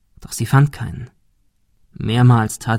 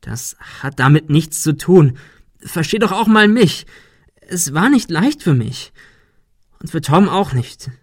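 higher, louder plea for understanding; pleading; 6 semitones higher, 4 dB louder than usual. A narrator's reading. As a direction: beg her to understand. Ben pleads with Sarah. (flehende Rechtfertigung)

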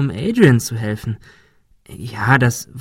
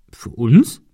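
louder, surprised little question; astonished; 5 dB louder than usual. A narrator's reading. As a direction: Ben surprised the gift is for both, astonished. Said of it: "fragte Ben erstaunt".

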